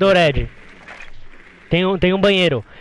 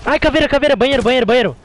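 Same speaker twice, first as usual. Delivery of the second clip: high, loud callout; near-miss alarm, shouting position; urgent shout; 8 semitones higher, 4 dB louder than usual. Real, not acted. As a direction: yell the callout to the team. Urgent enemy callout to team.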